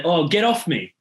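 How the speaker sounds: Australian accent, "Get off me" with no "of". Shown as a demonstration. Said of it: "I'd say".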